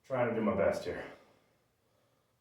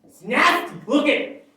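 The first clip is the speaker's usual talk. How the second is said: high, loud disgusted jeer; mock disgust; 8 dB louder than usual. Real, not acted. Mocking her brother's looks, high disgusted jeer. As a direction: squeal it out in fake revulsion.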